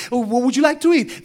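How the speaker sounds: high, put-on accommodating voice; placating customer service. Gate agent offering food to angry passengers, put-on placating voice. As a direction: play the eager-to-please agent.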